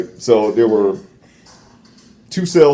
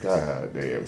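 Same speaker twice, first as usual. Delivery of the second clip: awed mutter; 10 semitones lower, 13 dB quieter than usual. Retold or imitated. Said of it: "I was like".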